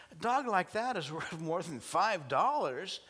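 high, emphatic objection; acted incredulous protest. Guy protests the low price.

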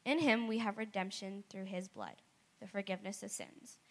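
usual speaking voice

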